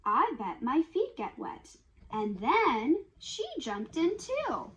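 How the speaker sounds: bouncy, sing-song picture book reading; reading to children. Acted. Parent reading muddy piggy book to kids, sing-song and lively. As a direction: read it like a lively bedtime rhyme.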